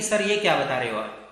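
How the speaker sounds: lightly mock a doubting student; a hint of a sceptical pupil; imitating student. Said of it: "आप इसे अन्यथा न लें कि".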